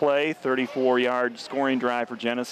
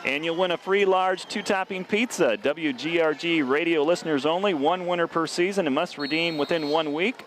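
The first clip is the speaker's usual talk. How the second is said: bright, raised ad-read voice; radio ad read; 6 semitones higher than usual. Acted. Announcer reading the pizza promo in a pitched-up ad voice. Reads a sponsor ad.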